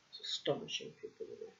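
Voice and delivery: breathless whispered aside, fast; incredulous whispered aside. Real, not acted. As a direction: mutter it under your breath, quickly. Muttered aside in rant.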